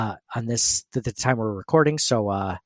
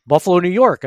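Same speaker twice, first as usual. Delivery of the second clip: ordinary talk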